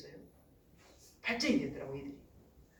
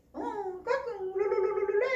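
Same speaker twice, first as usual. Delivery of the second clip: high, playful cooing and babbling; baby talk; 13 semitones higher, slower than usual. Retold or imitated. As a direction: imitate a mother cooing to her baby. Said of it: "앉아서 엉아리 할 때".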